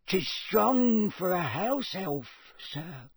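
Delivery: high, slow squeaky elf voice; squeaky house elf. A narrator's reading. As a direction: squeak it out slowly.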